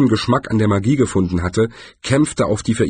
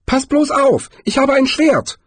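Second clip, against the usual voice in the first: much higher, squeaky would-be threat; squeaky threatening voice; 4 dB louder than usual. A narrator's reading. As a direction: squeak out a threat, trying to sound menacing. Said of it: "Eine piepsige Stimme, die versuchte, drohend zu klingen".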